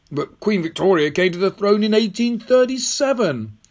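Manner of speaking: put-on high, rising boyish disbelief; incredulous protest. Tom challenging the old woman's claim, incredulous. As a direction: object in bewilderment, pitch climbing.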